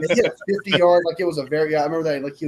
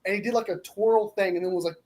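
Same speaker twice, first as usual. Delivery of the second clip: ordinary talk, nothing marked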